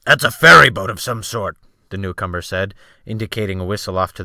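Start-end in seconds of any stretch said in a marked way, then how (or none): 0.1-1.6 loud sailor. A narrator's reading. Sailor calls out ship sounds.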